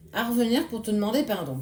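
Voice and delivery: usual speaking voice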